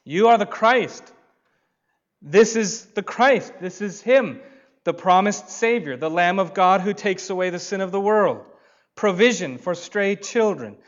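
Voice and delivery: high, loud, ringing proclamation; soaring sermon climax; preaching at full force. Acted. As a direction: build it up and proclaim to the congregation.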